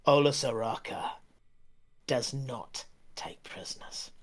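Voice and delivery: soft, breathless threat trailing to a whisper; dying brigand whispering his threat; menacing whisper. Acted. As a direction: hiss the name, then breathe the rest.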